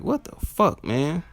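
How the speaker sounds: high-pitched exasperation; dismayed reaction. Retold or imitated. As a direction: replay your baffled letdown. Acting out dismay at the music.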